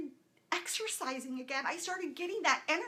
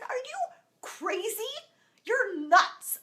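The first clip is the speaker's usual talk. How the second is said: high, loud disbelief; incredulous; 9 semitones higher, 8 dB louder, slower than usual. Retold or imitated. Mother scoffing at being told to post her video. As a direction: scoff at the idea in shock.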